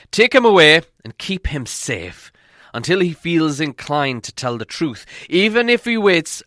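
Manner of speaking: the elf king commanding his guards, loud and high; royal command. A narrator's reading. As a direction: order the guards to take him, loud.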